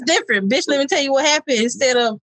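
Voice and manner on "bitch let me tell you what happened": "Bitch, let me tell you what happened." said light and chatty, "tell" stressed; casual homegirl gossip opener; "Bitch" as a friendly greeting, upbeat. Shown as a demonstration. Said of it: "It's the tone".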